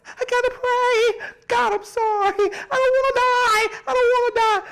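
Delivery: put-on high, fast, giggling panicky kid voice; scared little child. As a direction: mimic a frightened toddler begging. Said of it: "That baby say".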